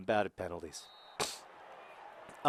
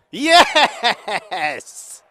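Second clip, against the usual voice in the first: joyful yell; 10 semitones higher, 11 dB louder than usual. Real, not acted. Penalty scored.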